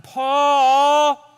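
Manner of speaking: high, loud last call; one last loud shout for the cat; final desperate call for the lost cat; yelling. Retold or imitated. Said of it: "So I yell out one last time".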